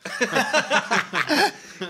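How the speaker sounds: big high burst of laughter; loud laugh. Real, not acted. Laughing at his own age joke.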